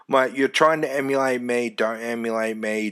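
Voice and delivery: normal talking voice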